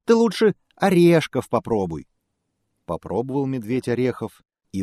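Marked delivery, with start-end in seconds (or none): 0.1-2.1 squirrel voice. Acted. Squirrel voice continues.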